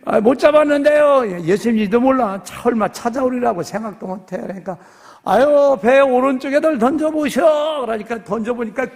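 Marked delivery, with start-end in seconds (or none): 0.0-1.3 loud, much higher answer from a boat; shouted reply. Acted. Fisherman shouting back to Jesus from the boat. 5.2-7.8 loud, higher call across the water. Acted. Jesus calling to the fishermen, loud and higher.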